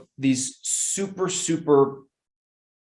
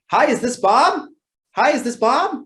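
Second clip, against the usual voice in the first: much higher, louder, bubbly hello; excitement; 10 semitones higher, 4 dB louder than usual. Shown as a demonstration. Salesman showing trainees an enthusiastic call opening. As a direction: greet him like great news. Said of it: "starting off the phone call with enthusiasm".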